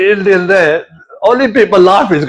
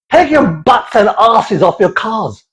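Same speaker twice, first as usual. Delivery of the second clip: ordinary talk, nothing marked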